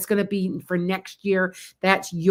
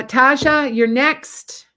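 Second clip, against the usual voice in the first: raised, louder summons; calling out; 6 semitones higher, 6 dB louder, much slower than usual. Real, not acted. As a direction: call up the next person in line. Summoning the next viewer by name.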